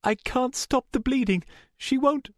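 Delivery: very high, panicky distress; frightened high-pitched report; shaky voice. A narrator's reading. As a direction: plead for help in a shaky voice. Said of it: "Rachel's voice was shaky".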